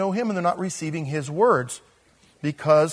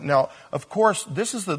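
normal talking voice

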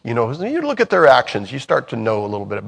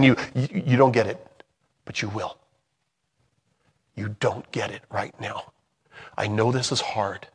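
soft, quiet voice of God; gentle reassurance; 7 dB quieter than usual. Pretend. God speaking softly to a confused believer, gentle and quiet. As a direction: comfort a confused child softly.

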